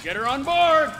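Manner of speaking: shouted order. Acted. Guard shouting to crew to load the truck, loud command. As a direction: call it out across the bay.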